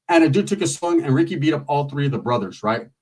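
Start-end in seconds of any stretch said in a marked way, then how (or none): none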